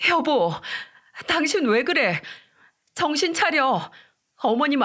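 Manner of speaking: high, urgent shaking-awake voice; frantic pleading. A narrator's reading. Wife shaking her sleepwalking husband awake, frantic. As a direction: desperately snap him out of it.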